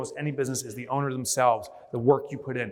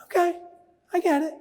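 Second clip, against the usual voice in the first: meek turtle head; 12 semitones higher than usual. Pretend. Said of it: "And it's like".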